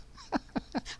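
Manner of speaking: light, breathy chuckle; soft high giggle; amused laugh. Real, not acted. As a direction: laugh softly at an absurd remark. Amused at what Merlin says.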